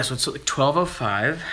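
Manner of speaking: ordinary talk, nothing marked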